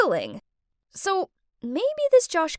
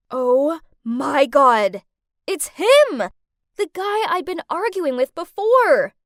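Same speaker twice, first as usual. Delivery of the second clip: shocked recognition; 2 semitones higher, 6 dB louder than usual. Acted. Captive recognises the man at the door, loud shock. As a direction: exclaim it loudly, stunned.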